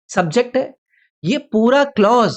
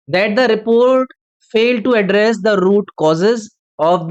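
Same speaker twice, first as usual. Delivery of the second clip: slow dictation read; much slower than usual. Shown as a demonstration. Teacher reads the question sentence.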